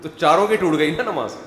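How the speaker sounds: usual speaking voice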